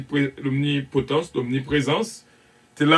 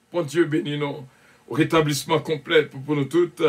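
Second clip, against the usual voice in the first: ordinary talk, nothing marked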